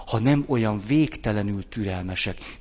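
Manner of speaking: ordinary talk, nothing marked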